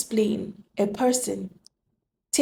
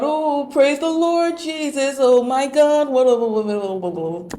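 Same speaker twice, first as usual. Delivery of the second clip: hammed-up churchgoer praising loudly, trailing into babble; a parent in church loudly praising God with the mouth that insults at home; mock church praise; 3 semitones higher, 3 dB louder than usual. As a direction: parody a parent gushing in church. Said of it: "You praise God".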